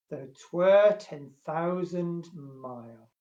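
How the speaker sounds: loud, measured love-poem reading; poem recitation. Acted. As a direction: declaim each line, opening strong and stretching the endings. Reads a love poem aloud.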